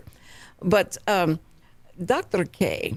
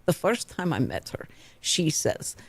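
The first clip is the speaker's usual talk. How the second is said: ordinary delivery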